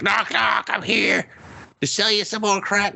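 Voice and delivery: high, singsong drug dealer; mock cheery dealer. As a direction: play the creepy dealer all bright and chirpy. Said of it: "and he's just like".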